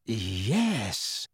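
soft, drawn-out hushed yes; whispered. A narrator's reading. Harry moving toward the Thestrals, whispered. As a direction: breathe it out in a hush. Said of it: "he whispered".

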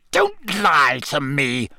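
slow, drawn-out menacing hiss. A narrator's reading. Snape accuses Harry of lying, hissing. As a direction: stretch each word with menace. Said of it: "Snape hissed".

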